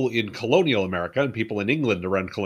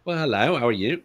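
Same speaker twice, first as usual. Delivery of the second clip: playfully imitated Cockney greeting; mock Cockney; 3 semitones higher than usual. Shown as a demonstration. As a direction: slip into a mock Cockney accent. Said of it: "I know that's Cockney".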